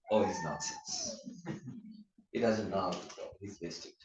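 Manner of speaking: quiet, sneering put-down, slowed; mock dismissive gossip. People gossiping behind a friend's back, quiet and sneering. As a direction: dismiss him under your breath.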